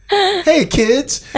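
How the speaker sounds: put-on high, chirpy greeting to children; sing-song hello to young listeners; hammed-up kids' show voice; mock kids' host.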